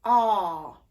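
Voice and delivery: long open ah, sung from the back of the mouth. Shown as a demonstration. Voice teacher demonstrating open back ah. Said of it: "the most open back vowel that we're going to explore is".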